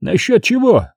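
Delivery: high, lively question; perked up. A narrator's reading. Monk perking up at the commander's question, lively. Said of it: "оживился монах".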